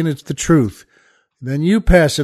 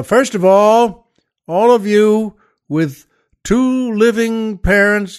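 drill sergeant call; 5 semitones higher, slower than usual. Acted. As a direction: bark it out to the men in formation. Drill sergeant addressing men.

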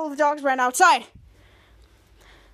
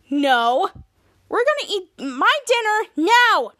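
stubborn, slow-building demand, a bit higher; angry insistence; 4 semitones higher, 4 dB louder, slower than usual. Acted. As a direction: put your foot down, dragging out the words. Piper stops parents leaving dinner.

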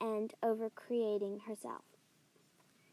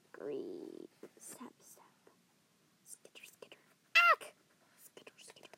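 hushed creeping whisper; whispered sound effects; 11 semitones lower, 4 dB quieter than usual. Acted.

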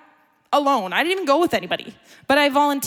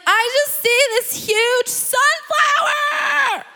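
high, slow, booming shout of wonder; retelling the speaker's ecstatic vision cry; excited shout; 13 semitones higher, much slower than usual. Retold or imitated.

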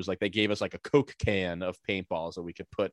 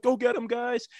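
high-pitched put-on kid leader; mock commando order; 14 semitones higher than usual. Teen paintballer to his team, mock high-pitched battle cry. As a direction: send the squad in, imitating high.

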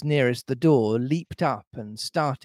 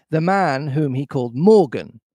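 ordinary delivery, nothing marked

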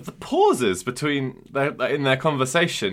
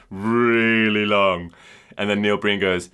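ordinary talk, nothing marked

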